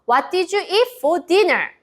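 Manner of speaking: very slow question pitched high; slow, high model question. Shown as a demonstration. Teacher modelling the question for kids to repeat. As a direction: stretch the question for the class. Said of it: "잘 듣고 따라해 보세요".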